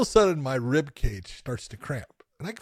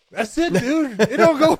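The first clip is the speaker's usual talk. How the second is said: mock exasperated; 9 semitones higher than usual. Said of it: "and it's like".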